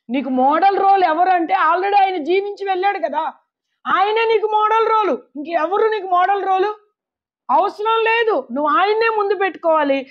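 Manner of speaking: high, emphatic exhortation; impassioned pulpit insistence; full-force preaching. Real, not acted. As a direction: hammer the point home to the congregation.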